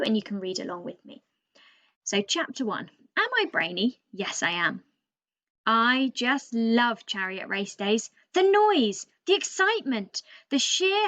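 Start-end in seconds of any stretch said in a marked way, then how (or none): 2.0-8.1 a chatty, know-it-all bird telling the tale with lively swings in pitch; cheeky raven storyteller. Acted. Teacher reading to Year Three as the boastful raven narrator, lively and cheeky. 8.3-9.0 excited. Acted. Raven thrilled about race day.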